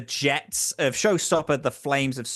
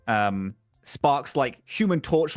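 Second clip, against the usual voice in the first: ordinary talk, nothing marked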